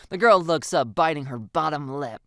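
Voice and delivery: ordinary delivery, nothing marked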